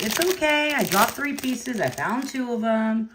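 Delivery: calm him down gently and slowly; a light imitation of her own soothing reply; retold reassurance. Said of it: "I was like".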